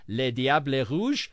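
French team nickname in a careful French accent; slow, deliberate go at a French accent; best French accent. Shown as a demonstration. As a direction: attempt your best French pronunciation, taken slowly. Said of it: "that in my best French".

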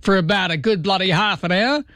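broad Australian caricature, pitched high; exaggerated Australian radio caller; hammed-up Aussie caller. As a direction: lay on the Aussie slang and twang thickly. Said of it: "make up a name".

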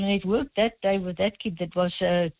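normal talking voice

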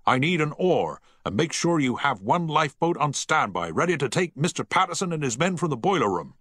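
firm naval command; the captain, commanding. A narrator's reading. Captain orders the crew to the lifeboats, commanding.

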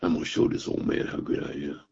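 muttered under the breath, cocky and low; a young man's quiet swagger; cocky boast. Retold or imitated. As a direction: brag to yourself half-whispered. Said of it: "I was so full of myself".

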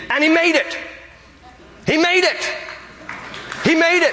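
pitched very high, held ringing exclamation; exultant amazement. Real, not acted. Preacher marvelling that the thief made it, exultant. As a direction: marvel at it in short high bursts.

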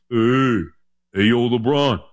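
low, drawn-out movie boxer call; Rocky. Acted. Rocky statue greets LeBron in Philadelphia, low drawn-out impression. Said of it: "Right next to Rocky".

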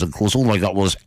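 ordinary talk

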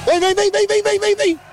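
high rapid-fire calling; chamando com pressa. Real, not acted. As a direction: urge them over in an insistent high chant. Urging passer-by to hurry to camera. (vem insistente, agudo e apressado)

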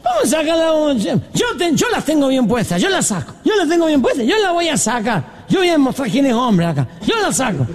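put-on high, blustering drunk voice; boastful drunk. Drunk volunteers to ask her to dance, high blustering voice. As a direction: brag like a drunk with bravado to spare. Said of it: "Y un borracho dice".

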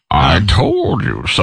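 low, slow Bane impression. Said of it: "Nothing worse than Bane telling you".

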